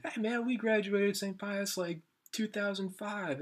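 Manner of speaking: high, drawn-out mock stranger; retold drunk greeting. Drunk partygoers hail the friends, retold high and slow.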